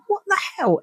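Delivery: very high, incredulous squeak without extra volume. Retold or imitated. Woman hearing partner swear in his sleep, incredulous. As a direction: react in high-pitched disbelief.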